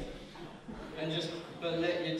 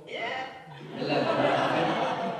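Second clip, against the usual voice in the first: laughing; 5 semitones higher, much slower than usual. Real, not acted. Laughing at eleven-year-old self.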